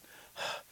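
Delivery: heavy puff of air; acted out-of-breath exhale; huffing breath. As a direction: huff as if winded from running. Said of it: "huffing and breathing".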